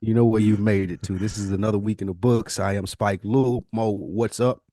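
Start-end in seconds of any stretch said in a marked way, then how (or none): none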